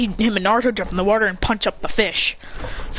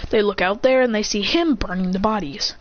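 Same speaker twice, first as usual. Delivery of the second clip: ordinary talk, nothing marked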